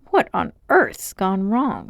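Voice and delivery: usual speaking voice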